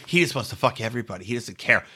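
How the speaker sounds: low, fast muttered imitation; quick, low mock insinuation; mock gaslighting. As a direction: rattle it off softly as an accusation.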